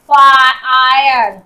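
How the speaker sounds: loud, very slow फायर as "faaa-aayar"; drawn-out sounding out. Shown as a demonstration. Teacher showing the I sound, फायर loudly drawn out. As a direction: stretch फायर loudly through its long "aai". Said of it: "आई का sound आई के रूप में".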